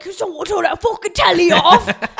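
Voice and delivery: grumpy Brit demands the telly go off; mock British grump. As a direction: drag out the complaint in a cockney voice. Grumpy man at TV.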